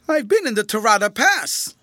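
loud, high-pitched cry; calling out. A narrator's reading. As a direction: call it after him to stop him. Tramp calls after departing general.

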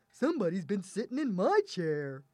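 lightly voiced middle-sized bear; a milder character voice for the middle bear. A narrator's reading. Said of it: "the middle-sized bear said".

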